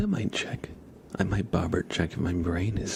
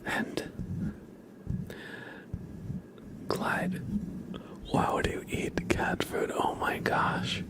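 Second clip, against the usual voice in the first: ASMR whisper. Real, not acted. ASMR whispered queue and name traces.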